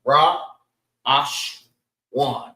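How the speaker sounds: Ra... Ash... Wan, with pauses between; Ra lifted, then Ash, then Wan; three syllables. Shown as a demonstration. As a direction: sound out Ra, Ash, Wan one by one. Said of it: "They have three syllables".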